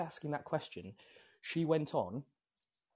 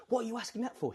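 higher-pitched retold indignant question; mock offended; 10 semitones higher, faster than usual. Man offended by a confusing question, mock indignant. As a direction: imitate someone taking offense, pitch jumping up. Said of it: "He took offense".

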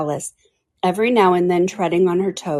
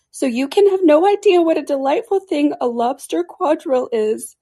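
high-pitched creature voice; Mock Turtle, earnest; 9 semitones higher, 4 dB louder than usual. A narrator's reading.

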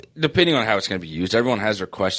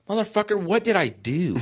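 high, incredulous what did I do; mock exasperated outcry; 11 semitones higher than usual. Reading a diagnosis that says death, a high mock outcry. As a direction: throw up your hands at the screen, up high. Reacting to a scary search result.

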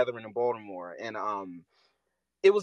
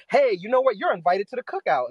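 higher, louder chirpy put-on voice; mock cheerful ally; 7 semitones higher, 5 dB louder than usual. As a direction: play the eager ally proudly handing out an invite. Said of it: "go and say".

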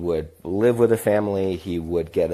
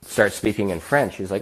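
ordinary talk, nothing marked

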